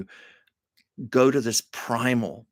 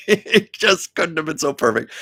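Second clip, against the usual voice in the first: laughing; 7 semitones higher, 5 dB louder than usual. Real, not acted. Laughing at perfect memory.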